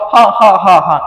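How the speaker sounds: mock hearty laugh; father chuckling at child; father's laugh. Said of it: "malah bapaknya ketawa".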